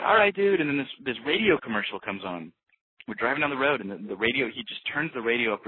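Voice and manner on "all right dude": high, loud mock "all right, dude"; wary humouring. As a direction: humour the weirdo, a little alarmed. Humouring the odd driver.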